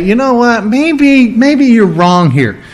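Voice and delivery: high, musing mock hesitation; acted-out mulling it over, drawn out at the end; thoughtful, tentative. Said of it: "Jesus didn't think about it and say".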